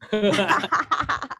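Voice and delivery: high burst of real laughter; short, high-pitched laughing fit; amused laugh. Real, not acted. Reacting to the class's teasing.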